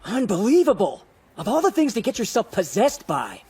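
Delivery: exasperated rant. Acted. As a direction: rant in disbelief. Boss exasperated at assistant.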